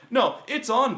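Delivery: loud, high-pitched defiant comeback; defiant retort. Retold or imitated. Game master answers protesting players, loudly defiant. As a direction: throw down the challenge loudly.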